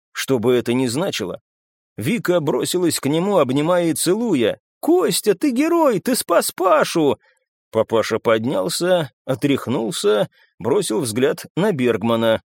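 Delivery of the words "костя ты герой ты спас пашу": high-pitched joyful praise; excited woman. A narrator's reading. Вика throwing herself on Костя, gushing. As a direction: gush in a much higher voice. Вика hugs and kisses the hero.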